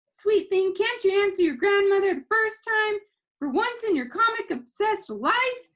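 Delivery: high, loud scolding grandma voice; exasperated grandma. A narrator's reading. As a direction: chide him like a fed-up grandmother.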